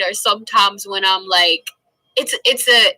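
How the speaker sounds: ordinary talk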